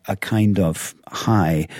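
usual speaking voice

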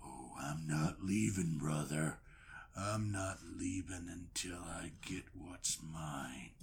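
low, slow growl; deep villain taunt; menacing wrestler. Acted. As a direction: loom over him with a deep threat. Esau menacing reply.

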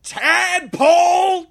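high, drawn-out shouted Tadpole; yelled insult. Retold or imitated. Principal lady yelling at the fleeing car, long high shout. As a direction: hurl it after the car.

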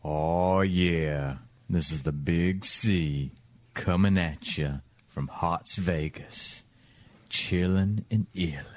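low, soft radio DJ persona. Pretend. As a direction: play a laid-back late-night DJ.

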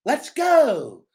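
silly character voice; quirky catchphrase. Shown as a demonstration. Wrestler mocking a quirky silly catchphrase, bouncy. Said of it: "But I can't be like".

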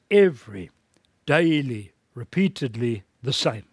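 "every" hit loud, "daily" hit loud, then "repeatedly the same"; stressed key words. Shown as a demonstration. Preacher repeating the key words of the verse, loud on "every" and "daily". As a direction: bang out "every" and "daily" as separate blows. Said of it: "The emphasis".

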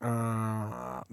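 long, low, soft bear moan; drawn-out low animal moan; imitated bear moan. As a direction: imitate a bear moaning quietly and steadily. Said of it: "just moaning".

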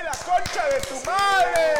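loud, slow, high comic yell; mock Argentine shout. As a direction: stretch it out like a stadium chant. Comic greeting in Argentine slang.

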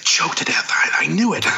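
hushed gleeful whisper. Acted. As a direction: whisper it like a secret. Mocking a gleeful listener.

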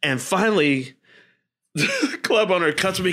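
usual speaking voice

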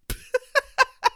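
high helpless burst of laughter. Real, not acted. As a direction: crack up at your own bit. Cracks up after reading impression.

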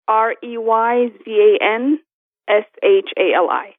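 slow, letter by letter; spelling it out. Real, not acted. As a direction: spell each letter out clearly. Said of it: "Can you s-, spell that for us".

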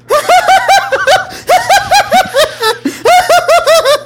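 huge high-pitched cackling laugh; maniacal laugh. Shown as a demonstration. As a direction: let out a wild, shrieking villain laugh. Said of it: "like this?".

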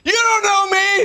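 high, slow, wounded cry of protest; angry outburst. Acted. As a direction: throw it back hurt and furious. Improv angry sad man.

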